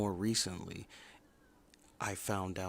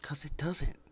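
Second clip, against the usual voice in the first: higher, whispered agreement; a sly whispered aside; 7 semitones higher, 3 dB louder than usual. Real, not acted. Vlogger whispering agreement with their own opinion, sly aside.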